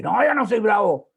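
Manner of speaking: loud, high, indignant insistence; a mock-furious protest; angry denial. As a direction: snap back that you're not angry at all. Angry man denies being angry.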